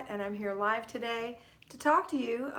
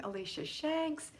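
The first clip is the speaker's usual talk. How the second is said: ordinary talk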